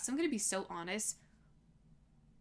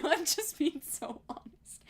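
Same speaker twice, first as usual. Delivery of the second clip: laughing; 7 semitones higher, slower than usual. Real, not acted. Laughs through own angry rant.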